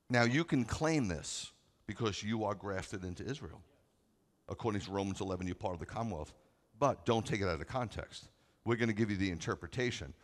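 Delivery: usual speaking voice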